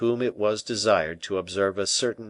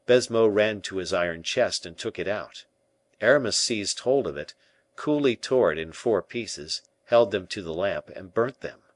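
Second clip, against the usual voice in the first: usual speaking voice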